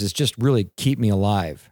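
usual speaking voice

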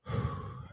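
imitating a long, heavy exhale; nervous sigh. Bandmate bracing before their set, heavy nervous sigh. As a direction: blow out a big breath before going on. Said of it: "he was just like".

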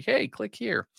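high, bright-eyed mock sales pitch; algorithm voice. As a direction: voice the algorithm luring clicks. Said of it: "to say".

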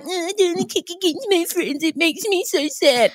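high, soft, whiny mock sob; mocking whiny contestant. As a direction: whine it like a sulky contestant. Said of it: "I don't wanna hear about".

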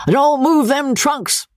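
high, slow bawl out a window; old squire yelling at a servant; bawling old baronet. Acted. Old baronet bawling at a servant from the window. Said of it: "was bawling out to a servant".

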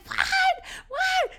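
very high, loud squeals of disbelief; imitated screaming. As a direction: shriek it like an overjoyed mom. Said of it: "She starts screaming".

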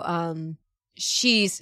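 ordinary talk

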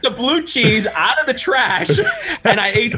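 high, giggling storytelling; can barely get it out for laughing; laughing through it. Real, not acted. Laughs telling the blue cheese story.

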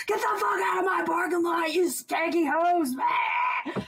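high, furious shout; Big Ed yelling. Retold or imitated. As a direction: yell them off the lot. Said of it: "you hear Big Ed".

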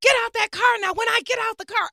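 mock exasperated yell; retold yelling. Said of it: "yelling at C J".

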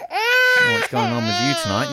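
mock crying. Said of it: "Oh, I hear him crying again".

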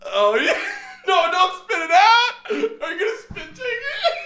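shrieking with laughter; high, loud laughing; laughing hard. Real, not acted. Friend nearly spits drink.

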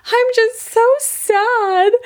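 very high, slow little-boy whimper, acted; imitated crying child. Little boy tells his dad after bedtime, acted tearful sadness. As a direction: draw it out like a mock-heartbroken small child. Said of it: "he's crying, crying, crying".

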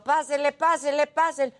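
high singsong vendor hawking; singsong market price calls. Retold or imitated. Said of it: "Y luego". (pregonero cantado de mercado)

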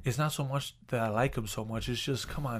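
very soft, secretive admission; imitated hushed confession. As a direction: mimic someone embarrassed to be overheard. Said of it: "Why are you whispering?".

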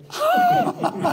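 sudden squeaky laugh; high burst of laughter. Real, not acted. Laughing at the insult.

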